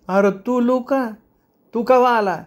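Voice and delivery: very high, drawn-out recognition; surprised old woman. Acted. Old woman squints and recognises the visiting boss, surprised. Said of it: "किलकिल्या डोळ्यांनी बघत म्हणाली".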